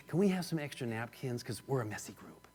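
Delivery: very soft, low, confidential request; imitated whisper. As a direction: lean away and ask under your breath. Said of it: "She leaned away from the group and whispered".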